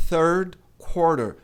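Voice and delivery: third quarter slowly, tongue between teeth, tapped T; slow model phrase. Shown as a demonstration. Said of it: "terceiro trimestre é". (third quarter devagar, com TH e T virando R)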